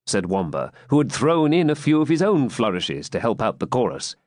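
plain reading voice

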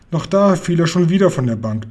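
plain reading voice

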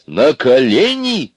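high, loud, drawn-out command; shouted order. A narrator's reading. Соня orders Аристарх back down, shouted order. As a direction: cry it out and stretch it. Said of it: "закричала она".

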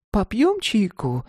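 put-on high, slow, winking old woman voice; playful grandma. A narrator's reading. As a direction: invite her sweetly like a granny. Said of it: "подмигивала бабушка".

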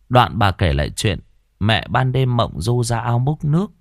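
plain reading voice

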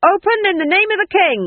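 loud, high official bellow; shouted command. A narrator's reading. Sergeant demanding entry for the king, shouting. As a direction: holler it through a closed door. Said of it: "a voice cried".

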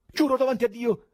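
high, loud, despairing cry; frantic high-pitched protest; desperate shout. A narrator's reading. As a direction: shout your innocence in despair. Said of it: "gridò disperato il presidente".

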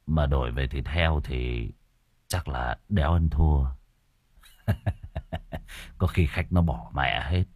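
low, sly scheming voice; scheming murmur. Acted. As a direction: mutter the plan to yourself. Killer muttering his scheme.

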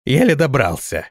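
ordinary delivery, nothing marked

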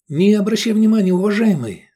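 high, loud, hasty appeasing; placating patter. A narrator's reading. Customer jumping up to placate cook, pattering. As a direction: babble to smooth it over. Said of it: "затараторил он".

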